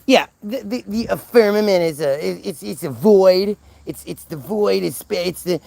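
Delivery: mock her fumbling through an explanation; mocking mimicry. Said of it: "she's, she's like".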